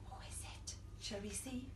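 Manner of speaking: soft unvoiced whisper; hushed whisper. Storyteller whispers to the kids before the reveal. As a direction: whisper it with suspense.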